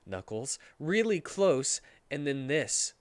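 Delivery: ordinary talk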